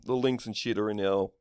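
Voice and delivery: normal talking voice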